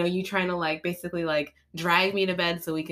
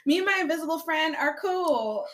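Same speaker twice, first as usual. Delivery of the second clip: higher, giggling through the words; laughing; 10 semitones higher than usual. Real, not acted. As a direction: laugh as you confess it. Laughing about talking to herself.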